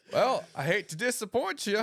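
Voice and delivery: high, loud put-on voice; exaggerated high-pitched reply; mock-apologetic comeback. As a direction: deliver a hammed-up apology. Acts out a comeback to her.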